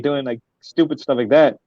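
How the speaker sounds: ordinary talk, nothing marked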